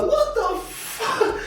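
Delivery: slow, very high laughing disbelief; stunned. Retold or imitated. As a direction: drag it out, amazed. Stunned by kids.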